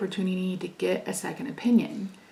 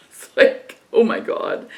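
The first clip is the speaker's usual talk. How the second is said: pained gasp; 2 semitones higher, 8 dB louder than usual. Retold or imitated. Recalling intense pain.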